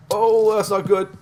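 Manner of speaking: sudden high, loud alarm; oh no, something just went wrong. Real, not acted. Sudden death, alarmed reaction.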